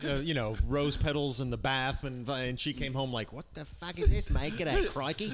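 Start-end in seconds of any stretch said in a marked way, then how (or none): 3.3-5.3 exaggerated Aussie impression; wife's Australian accent. Husband playing wife finding rose petals, in a cartoonish Australian accent. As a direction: ham up an outraged Australian wife. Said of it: "my wife's character on stage".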